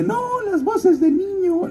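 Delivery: high-pitched godmother voice. Retold or imitated. Godmother asking the actor about his range, in a high put-on voice.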